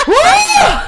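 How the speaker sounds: high laughing whoop. Real, not acted. Cheering out of breath.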